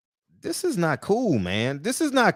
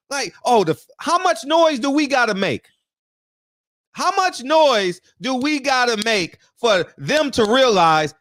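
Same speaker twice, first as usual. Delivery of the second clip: much higher, louder frustrated outburst; heated rant; 8 semitones higher, 3 dB louder than usual. Real, not acted. Fan venting at promoters over the fight, higher and louder frustration. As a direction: vent like a fan fed up after years of waiting.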